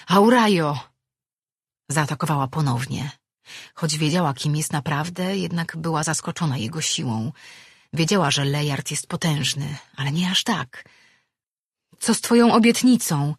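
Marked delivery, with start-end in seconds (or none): 0.1-0.8 loud, raised cry of a name; calling out. A narrator's reading. Attacked man calls to the woman striking him, loudly. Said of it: "zawołał, odzyskując równowagę". 12.0-13.3 loud, high challenge; crying out. A narrator's reading. Attacked man calls her to account, crying out. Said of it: "zawołał".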